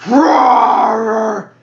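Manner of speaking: loud, drawn-out dragon roar; mighty dragon roar. Acted. Player acting out his dragon character's attack, mighty roar. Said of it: "I roar out a mighty dragon roar".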